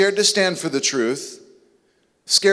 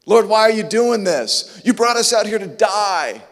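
complaining; 4 semitones higher than usual. Retold or imitated. Believers complaining to God in trouble, mock whining. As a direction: whine at God. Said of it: "we're complaining".

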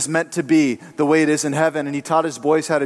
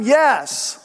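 a lightly raised, higher yes; mild mock yell; 7 semitones higher than usual. A narrator's reading. Wife yelling yes at her husband, mild mock yell. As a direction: snap a mock yell back at him. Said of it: "She yelled at him".

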